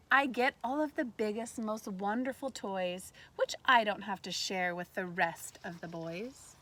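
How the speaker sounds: bright, lively read-aloud voice; reading to children. A narrator's reading. Teacher reading a picture book to kindergartners, lively read-aloud. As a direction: read it like story time for little ones.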